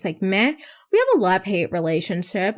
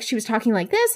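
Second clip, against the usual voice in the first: imitating fast talker; faster than usual. Shown as a demonstration. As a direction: rattle it off quickly. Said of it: "and she was talking so fast".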